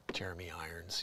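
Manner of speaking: low, hushed impression; Jeremy Irons. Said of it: "Jeremy Irons comes out to do the news".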